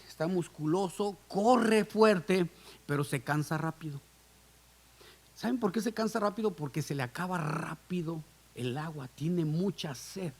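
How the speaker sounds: usual speaking voice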